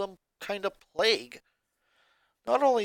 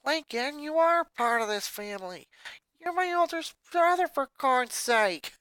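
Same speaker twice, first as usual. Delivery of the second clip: a lightly voiced pleading sister; little sister insisting he belongs; 11 semitones higher, 4 dB louder than usual. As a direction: reassure him earnestly. Lana pleads.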